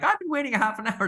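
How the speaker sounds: high, fast, whiny complaint; patient complaining about waiting, whiny; mock whiny patient. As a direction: whine like an impatient patient. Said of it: "And they're like".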